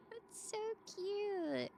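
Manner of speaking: slow, high cutesy coo. Real, not acted. Streamer cooing at a chat emote, slow cutesy.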